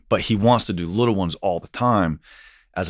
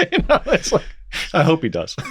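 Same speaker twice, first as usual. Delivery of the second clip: laughing. Real, not acted. Laughing at own Star Trek joke.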